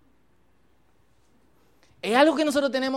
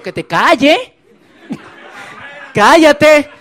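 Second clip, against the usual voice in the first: loud, higher scolding shush; hush order; 4 semitones higher, 9 dB louder, slower than usual. Acted. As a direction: shut him up, laughing. Disciples hushing the blind man.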